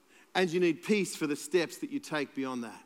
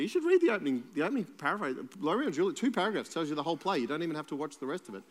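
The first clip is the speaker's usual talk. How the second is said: usual speaking voice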